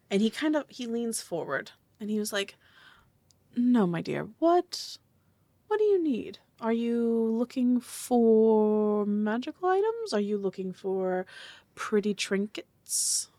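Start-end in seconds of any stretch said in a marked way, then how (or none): 3.5-13.3 coaxing merchant. Acted. Merchant sizing up a customer, slow and coaxing. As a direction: lean in and coax, stretching the key words. Game master voices merchant leaning in.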